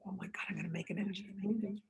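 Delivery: breathless unvoiced whisper of disbelief; awed whisper. Director retelling his awe at the studio offer, whispering. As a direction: whisper it like you can't believe it.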